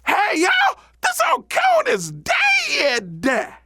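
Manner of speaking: very high, wailing holler; high, drawn-out shout to a crowd; hollering rabbit. Acted. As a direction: holler the news, stretching it out. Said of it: "stood up over old Coon and hollered".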